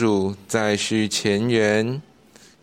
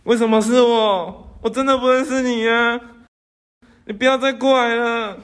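shrill high panicked pleading; 10 semitones higher than usual. Acted. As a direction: back away terrified. Frightened boy begs ghost.

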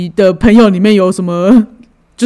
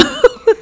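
short high laugh that drops away; burst of laughter; 5 dB quieter than usual. Real, not acted. Listener laughs at the lost-friend story with a short high burst.

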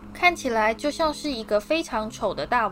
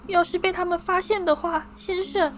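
eager house-elf Dobby; 8 semitones higher than usual. A narrator's reading. Dobby thrilled to finally meet Harry, high squeaky voice. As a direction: squeak it out, overjoyed.

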